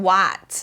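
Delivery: WHAT with a wide ah; wide open vowel. Shown as a demonstration. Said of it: "อย่างนี้ กว้างๆ หรือ what". (พูด what ปากกว้างเสียงอา)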